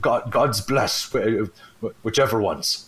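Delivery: lightly voiced beggar, slow and halting; a hint of a beggar fumbling a blessing; half-orc beggar. Half-orc beggar blesses a passerby for coins, lightly voiced, halting. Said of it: "this, like, half-orc beggar".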